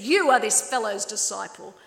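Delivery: loud, scornful taunting; jeering Pharisees shouting down the beggar; insulting sneer. Acted. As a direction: hurl it at him like an insult. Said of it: "Then they hurled insults at him".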